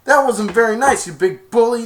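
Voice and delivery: high, loud telling-off; loud scolding. Retold or imitated. Players scolding Spike, high loud scold.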